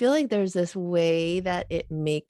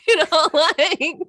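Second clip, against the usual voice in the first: laughing through the words; high laughing words; 11 semitones higher, 9 dB louder, slower than usual. Real, not acted. Laughing hard while talking.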